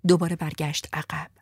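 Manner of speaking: plain reading voice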